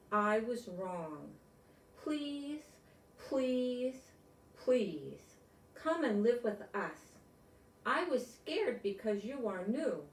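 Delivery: bright, storybook read-aloud voice; reading to children. Acted. Grown-up reading a picture book to kids, storybook voice. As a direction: read it like bedtime story time.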